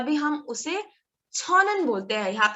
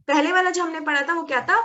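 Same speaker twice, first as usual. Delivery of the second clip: ordinary delivery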